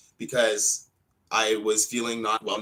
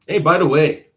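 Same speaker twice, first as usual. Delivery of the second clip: loud, higher attention-grabber; sudden raised-voice interjection; calling out; 4 semitones higher, 10 dB louder than usual. Real, not acted. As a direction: burst in loudly with a new topic. Abruptly calls out new topic.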